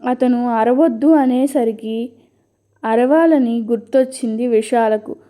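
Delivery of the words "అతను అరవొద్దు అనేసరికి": ordinary delivery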